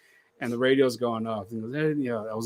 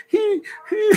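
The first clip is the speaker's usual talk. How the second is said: high, fake little sob-giggle; mock fake sobbing; 6 dB louder than usual. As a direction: mimic her fake crying in a squeaky voice. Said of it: "boo-hoo-hooing for something".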